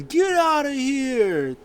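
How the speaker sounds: high, loud, drawn-out yell; mock shout. Imitating Edge ejecting a rival, mock shout. Acting out Edge throwing a wrestler.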